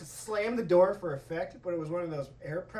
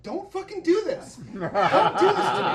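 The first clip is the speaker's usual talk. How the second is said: panicked plea; 8 semitones higher, 7 dB louder, faster than usual. Retold or imitated. Kid begging his giggling teammate to stop, panicked plea. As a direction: beg a friend in mock panic. Pleading with friend not to start laughing.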